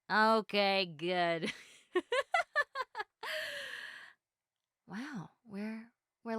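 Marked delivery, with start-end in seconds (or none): 1.9-4.0 a high, giggly burst of laughter. Real, not acted. Laughing along with the listener she invited, high giggle.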